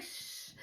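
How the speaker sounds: quiet, voiceless "shh"; soft shush. Retold or imitated. Telling her terrified friend to keep still, soft shush. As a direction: hush a frightened friend softly.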